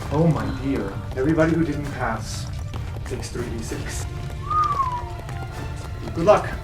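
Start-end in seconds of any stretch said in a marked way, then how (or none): none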